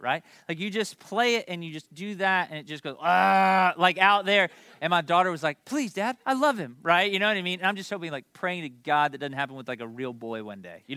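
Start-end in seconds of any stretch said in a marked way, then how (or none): 3.0-3.7 loud droning "ah" of a noise toy; imitated toy noise. Dad mimics the toy his daughter wants, loud ugly noise. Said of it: "vomits noise is the best way". 5.6-6.8 high-pitched imitation of a begging kid; pleading daughter. Daughter begging her dad for the toy, high pleading.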